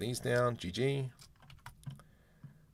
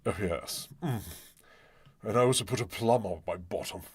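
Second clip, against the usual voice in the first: mock British accent. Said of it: "I speak British".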